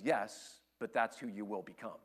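low, soft, tender reassurance; God reassuring. Acted. God answering a doubting believer, low and tender. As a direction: gently promise who they will become. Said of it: "And God says".

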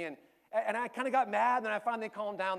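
ordinary delivery

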